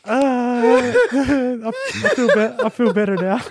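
high-pitched words broken by laughter; laughing while talking. Real, not acted. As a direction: try to speak through the tail of a laughing fit. Recovering from laughing at own joke.

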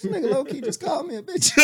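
high, fast, laughing it out; cracking up at the friend; laughing accusation. Real, not acted.

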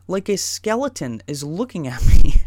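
loud burst breaking into the last word; interrupted by a bodily noise. Real, not acted. Telling his trip story, interrupted by a loud bodily noise. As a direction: end the line in a sudden loud body noise. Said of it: "Excuse me".

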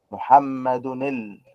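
محمد ال- run together as "muhammadu-nil", slowly; tanween linked onward. Shown as a demonstration. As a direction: stretch out محمد ال- with nun joining the lam. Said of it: "نون، لام".

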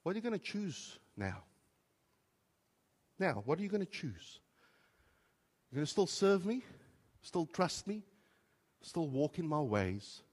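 soft, probing questions; gentle voice of God. Retold or imitated. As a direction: ask quietly, giving him the choice. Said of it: "as if God is saying to me".

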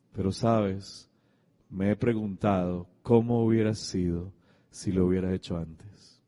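low, soft, subdued retold confession; pensive regret. Father's pensive confession after crying.

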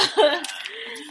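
high squealing laugh. Real, not acted. Laughing hard.

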